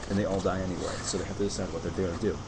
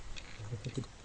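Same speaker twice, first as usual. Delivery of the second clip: laughing; 3 semitones higher, 8 dB quieter than usual. Real, not acted. Laughs at chills remark.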